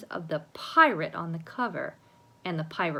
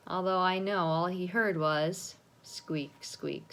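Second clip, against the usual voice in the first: plain reading voice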